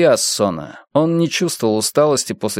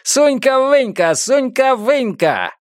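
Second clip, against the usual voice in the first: high, drawn-out taunting chant; mocking singsong holler, pitched way up; jeering hooligan yell; 12 semitones higher, slower than usual. A narrator's reading. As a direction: hoot it slowly like football hooligans. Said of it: "принимались улюлюкать и орать".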